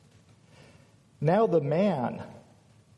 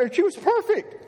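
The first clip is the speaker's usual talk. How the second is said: high, excited raving; acted-out delight, pitched way up; thrilled admiration. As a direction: gush over the perfect one. Acting Adam's delight at seeing Eve.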